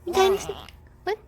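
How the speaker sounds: high playful coo. Real, not acted. Mom coos at her baby.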